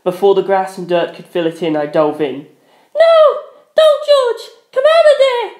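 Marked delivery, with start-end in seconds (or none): 2.9-5.5 very high, loud cry; high panicked scream to stop him; shrieking girl's plea; frantic cry. A narrator's reading. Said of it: "Penelope's cry".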